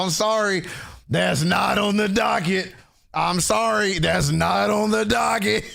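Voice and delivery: hammed-up telling off of the chat; mock scolding shout. Streamer refusing the chat's request, a hammed-up high shout.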